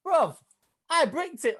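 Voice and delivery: high, loud storytelling exclamation; worked-up retelling. Retold or imitated. Interviewer recalls bricking it before the interview, worked-up retelling. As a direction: relive the panic, pitched right up.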